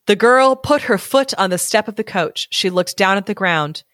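usual speaking voice

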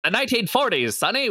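put-on high, old-timey Mid-Atlantic radio voice; 1940s Mid-Atlantic announcer lilt; Mid-Atlantic accent. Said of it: "We got the Mid-Atlantic accent here".

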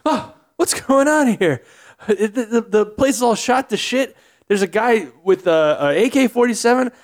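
a frightened mall shopper squeaking out his panic; panicky shopper. Acted.

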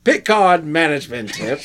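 mock British Picard, stress on Picard; Picard impression. Co-host asks for his Picard voice, hammed-up British. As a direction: ham up a British captain. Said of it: "Do it in his voice".

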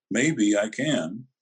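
"Maybe" drawn out slowly and stressed. Retold or imitated. Detective offering help to a suspect, heavy emphasis on "Maybe". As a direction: lean long on "Maybe", very slow. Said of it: "Emphasis on the word maybe".

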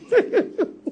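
high burst of laughter. Real, not acted. Preacher laughs at his own joke, high and free.

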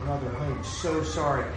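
ordinary delivery

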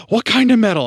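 gasp, then gush like a thrilled fan; girl lighting up over metal, mock excited gasp.

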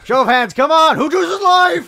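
very loud, high rallying yell; hammed-up battle cry to the troops; [name] as Braveheart. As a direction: shout it like a hero rousing an army. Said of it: "he thinks he's Braveheart".

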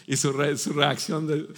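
normal talking voice

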